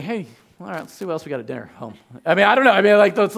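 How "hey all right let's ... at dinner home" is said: casual shrug. Retold or imitated. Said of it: "It's like".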